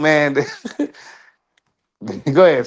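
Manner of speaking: normal talking voice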